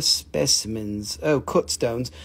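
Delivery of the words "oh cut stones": ordinary delivery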